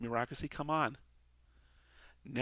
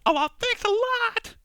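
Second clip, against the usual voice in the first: shrieking yell, far higher and louder; Sam Kinison scream; 12 dB louder than usual. Retold or imitated. Angel screams sarcastic thanks at the Lord, shrieking. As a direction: scream it up at heaven in fury. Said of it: "He's screaming at the Lord".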